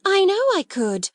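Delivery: eager assurance. Acted. Ermengarde assures Sarah she can fetch the food, excited. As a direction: promise it with girlish eagerness.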